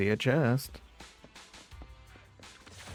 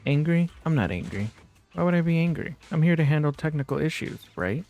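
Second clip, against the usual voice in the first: defensive denial; 7 semitones higher than usual. Acted. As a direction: insist you're not angry, too much. Voicing a character denying anger.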